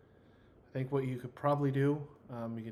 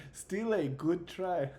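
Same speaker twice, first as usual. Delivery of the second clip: put-on light French accent, higher and slow; 6 semitones higher, 5 dB louder, slower than usual. Character consoling a player after the lightsaber joke, light French accent. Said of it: "I could do this French accent".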